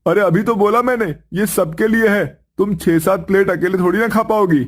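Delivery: husband calling his wife to serve the food; husband's voice. Acted. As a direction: play the cheerful husband bringing food home.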